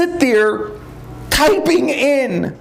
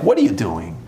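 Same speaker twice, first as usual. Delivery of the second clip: Satan's low jab; 14 semitones lower than usual. Retold or imitated. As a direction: lean in low and needle him. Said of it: "he's like".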